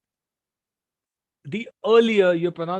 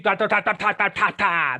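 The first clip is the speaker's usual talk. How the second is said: mock newsreader ta ta. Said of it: "He's only shouting all the time".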